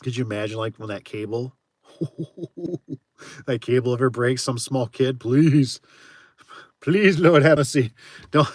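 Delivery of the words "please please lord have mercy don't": loud, slow plea for mercy; pleading exclamation. Real, not acted. Streamer imagines a cable snapping, loud pleading. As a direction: beg out loud as if watching it fall.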